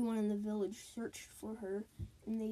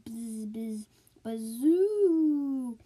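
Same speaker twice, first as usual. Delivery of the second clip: howling wind noise; 9 dB louder, much slower than usual. A narrator's reading. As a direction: blow it like a spooky wind. Wind noise in ghost story.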